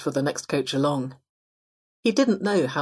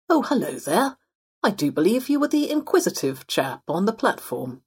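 genteel fox, pitched up and lilting; upper-middle-class fox; 5 semitones higher than usual. Acted. Fox greeting the possum from his seat, genteel and lilting. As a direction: play a well-to-do, chatty gentleman fox.

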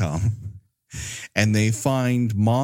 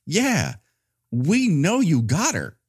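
mock exasperation; 8 semitones higher, slower than usual. Exasperated viewer talks back to movie.

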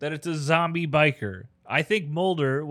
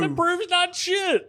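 high, slow dismissive jab; scoffing; 13 semitones higher, slower than usual. Real, not acted. Backing up his friend, slow high scoff. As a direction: drag it out high and scornful. Agreeing, mocking the evidence.

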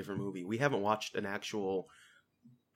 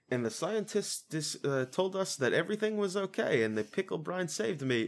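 Herschel impression; 8 semitones higher than usual. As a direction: stumble through it like a confused elder. Voicing Herschel on the news.

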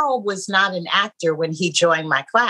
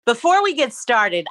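normal talking voice

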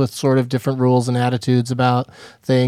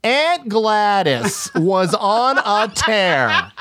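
slow, higher mock gossip voice; mocking chatter; 6 semitones higher, much slower than usual. Theatregoer mocks chatty audience members, slow and higher. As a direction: drag it out like a silly chatterbox before the show. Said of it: "people are just like".

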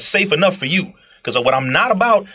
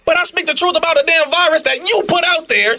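outraged; 11 semitones higher than usual. Real, not acted. Outraged complaint about censorship.

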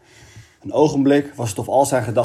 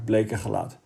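ordinary delivery

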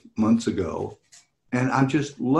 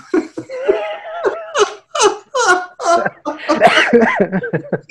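shrieking laugh; 4 dB louder than usual. Real, not acted. Laughing at own joke.

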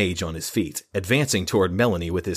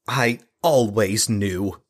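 withering tone; 3 semitones higher, much slower than usual. Acted. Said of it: "her tone as withering as the flames".

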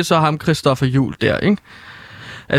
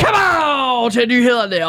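high, drawn-out shout sliding down; catchphrase yell; 7 semitones higher, slower than usual. Pretend. Journalist greets the news with his catchphrase, high drawn-out shout. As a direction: launch it like a superhero's battle cry.

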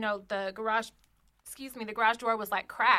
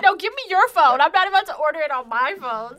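loud refusal; 5 semitones higher, 10 dB louder than usual. Retold or imitated. Refusing drunk Mark's request.

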